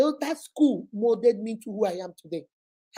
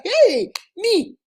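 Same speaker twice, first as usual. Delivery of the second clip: a high, louder "Hey, me" of offended shock; indignant disbelief; 8 semitones higher, 5 dB louder than usual. Retold or imitated. Girl told she's arrogant, indignant high exclaim. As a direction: recoil at the accusation.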